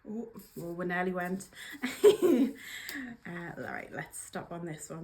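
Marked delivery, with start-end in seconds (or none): 1.5-3.2 loud surprised laugh; real burst of laughter. Real, not acted. Nearly dropping the cards while shuffling, a loud real laugh. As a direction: laugh out loud at a near slip.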